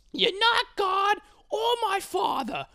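high, loud impression of an irate little man; slow mock tirade building to a yell; Bagel Boss screaming. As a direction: imitate a furious Long Island screamer. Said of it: "he's, like, screaming at the lady".

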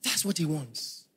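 quiet intense repeat; hushed repeat. Real, not acted. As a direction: bring it down to a hush. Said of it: "repeat dropped soft".

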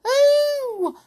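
very high, loud mouse squeal; frightened squeal. A narrator's reading. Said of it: "squealed the Clockwork Mouse in a fright".

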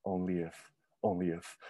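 soft, fading whispered "only if"; muttered regret. Retold or imitated. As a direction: mutter it to yourself, lost. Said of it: "you're just like".

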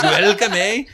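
teasing a friend with a loud Canadian voice; mock Canadian, Scottish-sounding. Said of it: "why did my Canadian sound Scottish".

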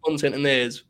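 ordinary talk

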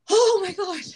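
high, loud overwhelmed sigh of a phrase; exasperated oh my gosh. Acted. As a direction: let the stress spill out, half-joking. Acting mildly overwhelmed.

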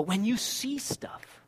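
slow secret whisper. Acted. Pastor confiding to congregation in a slow whisper. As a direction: lean in and whisper it slowly.

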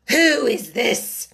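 stern, drawn-out royal question; slow, severe demand. A narrator's reading. The Queen questions the Knave of Hearts, severely. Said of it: "the Queen said severely".

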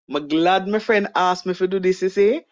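broad Jamaican patois, easy and casual. Shown as a demonstration. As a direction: say it the way you'd chat with friends back home in Jamaica. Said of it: "me chat patois".

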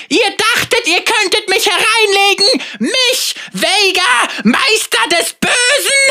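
hoher, lauter Schurkenspott; mustache twirling villain. Acted. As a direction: diabolisch triumphieren. Villain gloats. (triumphierender Bösewicht)

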